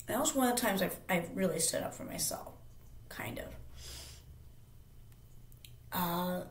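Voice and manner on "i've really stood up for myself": ordinary delivery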